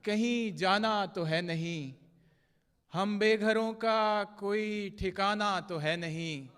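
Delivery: measured, projected mushaira verse with drawn-out line endings; poetry recitation. Acted. Poet reciting love couplets to a mushaira audience, declaimed Urdu verse. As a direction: recite the ghazal line by line, pausing between phrases.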